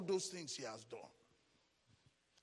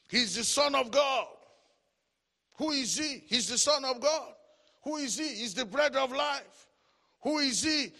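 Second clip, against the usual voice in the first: loud, raised call-and-answer preaching; forceful preaching; 3 semitones higher, 8 dB louder than usual. Acted. Preacher asking and answering who Christ is, forceful proclamation. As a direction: proclaim each title, punching it out.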